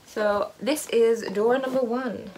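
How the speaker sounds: playful posh presenter voice, lifting on the question; mock-posh, emphatic reveal. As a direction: put on a posh accent to unveil the door. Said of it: "I have a posh voice sometimes".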